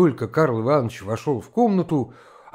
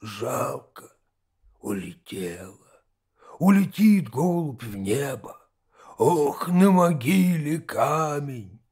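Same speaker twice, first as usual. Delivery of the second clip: slow, broken holy-fool rambling; talking to himself; slower than usual. A narrator's reading. Holy fool alone at his side table, talking to himself. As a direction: ramble absently, with long gaps between phrases. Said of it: "говорил как будто сам с собою".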